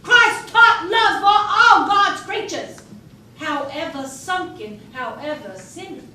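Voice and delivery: loud, forceful preaching to the secessionists; defiant declaration. Retold or imitated. Spy tells the secessionists slavery is unchristian, loud and defiant. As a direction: proclaim it like a sermon. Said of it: "here's what I told them".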